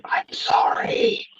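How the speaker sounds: silent whispered apology; mouthed. Acted. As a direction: mouth it without voice. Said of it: "read my lips saying".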